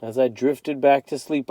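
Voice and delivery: usual speaking voice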